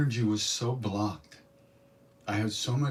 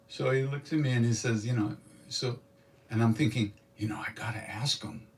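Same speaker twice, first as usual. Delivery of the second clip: ordinary delivery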